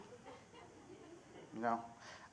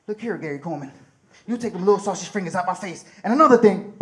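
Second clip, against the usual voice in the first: louder, faster tough-guy scolding; confrontational; 11 dB louder, faster than usual. Retold or imitated. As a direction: square up and lay into him. Confronting the dwarf.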